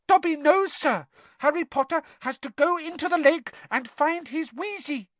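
high, squeaky house elf. Acted. Dobby speaking.

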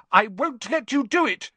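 high, loud standing firm; desperate defiance. A narrator's reading. Neville steeling himself to stop friends, desperate defiance. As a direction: refuse them, pitched right up. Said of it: "steeling himself to do something desperate".

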